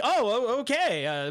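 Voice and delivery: stammered, thrown-off acknowledgement; shocked. Retold or imitated. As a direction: imitate stumbling into an okay. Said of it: "I'm just shocked".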